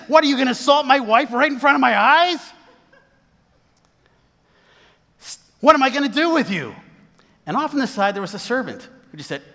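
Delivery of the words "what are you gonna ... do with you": high, loud acted outrage; hammed-up furious shouting; really mad. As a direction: thunder at him as an enraged king. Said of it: "he's really mad now".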